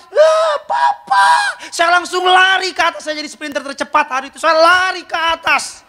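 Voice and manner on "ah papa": high, slow shouted call of Papa; child yelling for dad from upstairs; child's shout. Retold or imitated. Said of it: "Anak saya teriak".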